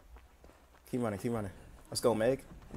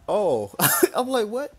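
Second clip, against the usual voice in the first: laughing; 13 semitones higher, 13 dB louder, slower than usual. Real, not acted.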